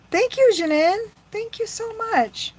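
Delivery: high, bright gushing gratitude; gushing thanks. Real, not acted. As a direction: thank her effusively. Thanking viewer in chat.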